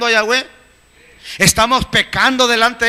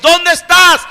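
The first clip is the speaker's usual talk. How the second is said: higher, loud call of God searching; 6 semitones higher, 5 dB louder than usual. Retold or imitated. God calling the hiding believer, loud searching call. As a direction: shout it out as if searching for someone hidden. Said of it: "te dice Yahweh".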